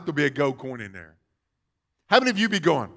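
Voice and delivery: normal talking voice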